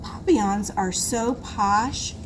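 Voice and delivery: usual speaking voice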